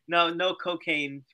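ordinary talk, nothing marked

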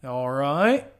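put-on Aussie accent with a rising end; mock Australian accent. Answering a mate about beer, mock Australian accent. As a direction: lilt it upward like a hammed-up Australian. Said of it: "if that's a good Australian".

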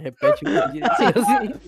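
high, laughing tease. Real, not acted. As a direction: squeal it through giggles. Teasing laughing friend.

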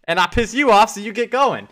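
high, loud mock yell; Wesley Snipes. Player goading his teammate to get going, imitated yelling. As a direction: yell it like a movie pep talk. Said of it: "Wesley Snipes is yelling at Woody".

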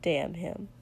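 usual speaking voice

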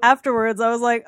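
ordinary delivery

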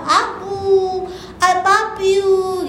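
put-on drawn-out, cooing little-child greeting; lightly mimicked loving grandchild; affectionate grandchild. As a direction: stretch it out like a grandchild running for a hug. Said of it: "cucu-cucu saya yang ada di gereja suka peluk saya".

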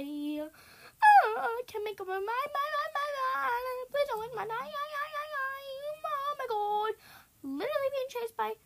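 high-pitched frantic babble, stuck on words; panicked wailing. Real, not acted. Gamer being chased by four, panicked wailing. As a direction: wail in a high stream of panic.

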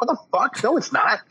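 high, incredulous mock outrage; outraged people. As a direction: squeal it like a shocked crowd. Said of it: "People are like".

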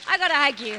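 a cheeky burst of affection; playful hug grab. Pretend. Pastor acts out hugging everyone.

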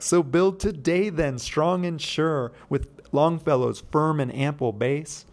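poem recital. Shown as a demonstration. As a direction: declaim the lines like a recital. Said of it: "I'll go back".

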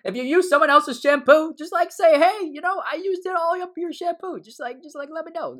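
high-pitched pleading advice; high mock plea. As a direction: plead way up high, half joking. Mock plea to shampoo users.

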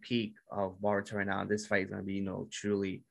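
usual speaking voice

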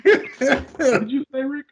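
laughing. Real, not acted. Didn't hear co-host's joke.

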